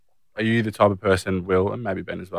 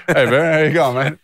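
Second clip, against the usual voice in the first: loud, higher laughing greeting with a fudged name; mumbled name cover-up; 8 semitones higher, 6 dB louder than usual. Shown as a demonstration. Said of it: "mumble, like, a, a B sounding word".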